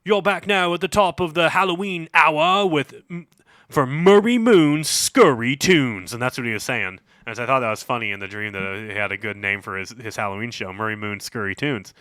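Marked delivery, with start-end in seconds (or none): 0.0-6.1 higher, slow, hammed-up radio host. Dream DJ opening his Halloween show in a hammed-up announcer voice. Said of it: "he's being like".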